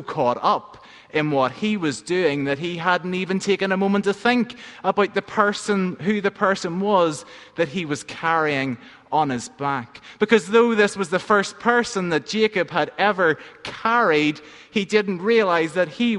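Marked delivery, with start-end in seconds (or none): none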